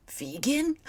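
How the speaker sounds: high rising disbelieving vegan; startled character; surprised question. Acted. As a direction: ask it up high in shock. Asks in disbelief.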